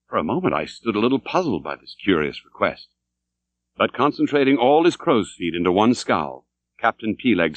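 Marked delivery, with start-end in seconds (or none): none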